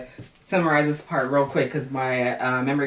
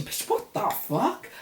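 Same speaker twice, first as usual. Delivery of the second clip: breathless, slow, high whispered shock; stunned whisper; 9 semitones higher, 6 dB quieter, much slower than usual. Acted. Playing Sabrina, hearing what happened, in stunned whispered shock. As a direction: gasp it out in disbelief.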